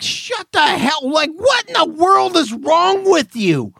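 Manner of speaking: high shouted disbelief; exasperated outburst. Retold or imitated. Host yelling at a bored retiree, exasperated shout. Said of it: "You're like".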